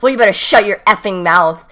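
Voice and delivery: loud mock-angry outburst; angry threat. Girl swearing at the teller, angry threat. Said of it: "she starts swearing at me".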